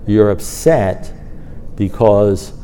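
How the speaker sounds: acted sponsor spelling it out slowly; measured, unhurried verdict; slow, deliberate pronouncement. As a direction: spell it out with long pauses between phrases. Acts sponsor delivering memorable line.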